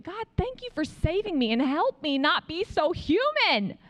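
earnest, flowing prayer of gratitude; sincere thanks. Retold or imitated. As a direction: thank God and really mean it. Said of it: "later I really meant it".

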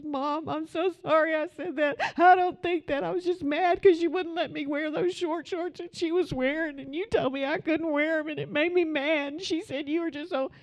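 high, tearful imitation of a sobbing girl; bawling daughter. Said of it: "and she's bawling".

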